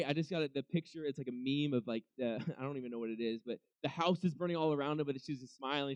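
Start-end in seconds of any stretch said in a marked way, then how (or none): none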